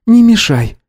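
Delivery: high-pitched curt woman's brush-off. A narrator's reading. Woman trying to sleep brushes off a caller, curtly. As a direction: cut him off, pitched high. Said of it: "отрезала Анна".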